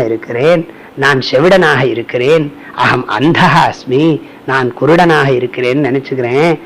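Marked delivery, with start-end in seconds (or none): 2.8-4.0 slow recitation. Shown as a demonstration. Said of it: "recites the scripture line".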